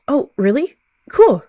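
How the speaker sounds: light up with delighted surprise; excited young cat. A narrator's reading. Naive young cat delighted by a stranger's offer, excited.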